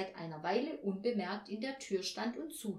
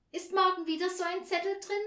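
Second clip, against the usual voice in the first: put-on high, quick child's question; eager child; 10 semitones higher, 6 dB louder, faster than usual. A narrator's reading. Child at bedtime asks about tomorrow's note, high eager voice. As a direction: ask fast and hopefully as a child.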